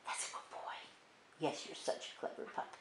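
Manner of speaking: soft whisper to the dog; whispered praise. Real, not acted.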